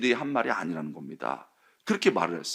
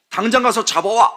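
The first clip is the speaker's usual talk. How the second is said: higher, louder barked order; 8 semitones higher, 6 dB louder than usual. Retold or imitated. Leaders ordering officers to arrest Jesus, loud barked order. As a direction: snap the order at them.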